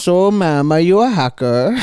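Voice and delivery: high, loud mock accusing; scammer voice. Mimicking the scammer questioning the girl, high and loud. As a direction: demand if she's a hacker. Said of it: "imitating the scammer".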